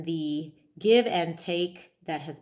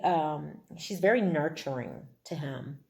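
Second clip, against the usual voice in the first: ordinary delivery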